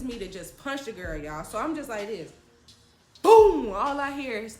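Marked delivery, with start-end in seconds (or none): 3.2-3.7 explosive, very loud boom; sudden impact shout. Retold or imitated. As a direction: punch it out like a blow landing. Imitates the punch landing.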